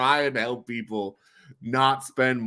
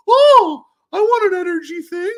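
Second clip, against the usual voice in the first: high, loud mock eager voice; 12 dB louder than usual. Retelling his own impulse buy, high loud mock eagerness. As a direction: squeal it like a greedy kid. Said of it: "I was like".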